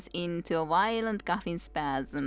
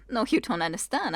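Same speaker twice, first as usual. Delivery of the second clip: ordinary talk, nothing marked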